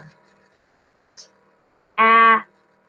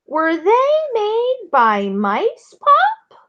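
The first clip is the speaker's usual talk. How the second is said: much higher, slow and curious; child's question; 11 semitones higher, much slower than usual. A narrator's reading. Val asking Pop about mice tracks, high and curious. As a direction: ask Pop wide-eyed.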